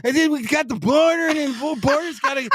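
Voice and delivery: high, stammering whine; mock a flustered, whiny politician; DeSantis. Co-host imitating the candidate on the border, whiny stammer. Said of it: "It's like this".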